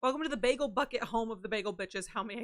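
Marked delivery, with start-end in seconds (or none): none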